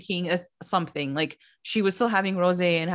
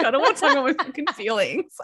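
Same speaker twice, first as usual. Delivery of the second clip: fast, higher, grumbling imitation of a reluctant guest; mock grumpy refusal; 4 semitones higher, faster than usual. Gabriella grumbling to Louis about sharing feelings, fast and higher. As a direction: toss it off like she'd rather die than share.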